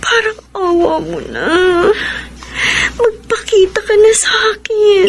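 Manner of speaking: crying, begging him to appear; tearful plea. Acted. Jessica begging the absent Peter to show himself, crying. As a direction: plead through tears, rising at the end.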